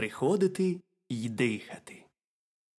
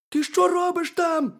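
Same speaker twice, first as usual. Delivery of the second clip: high, squeaky little hedgehog; very high, loud cartoon critter voice; 9 dB louder than usual. A narrator's reading. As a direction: pipe it up as a tiny curious hedgehog. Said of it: "запитав їжачок".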